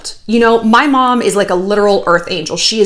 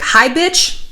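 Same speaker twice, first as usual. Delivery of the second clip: normal talking voice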